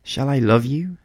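a small bird asking straight out; swallow voice. A narrator's reading. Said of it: "said the swallow".

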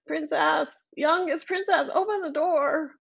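high, eerie voice calling through a door; frog's voice. A narrator's reading. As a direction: call high and sing-song as a creepy visitor. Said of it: "and a voice said".

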